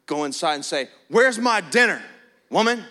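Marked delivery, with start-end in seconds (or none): 1.1-2.8 loud, higher mock-grumpy bark; a hammed-up angry husband barking at his wife; grumpy demand. Husband home from work, mock-angry, demanding dinner from his wife. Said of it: "all mad and grumpy".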